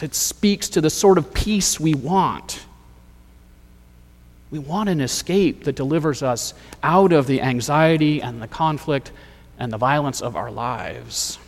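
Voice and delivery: ordinary talk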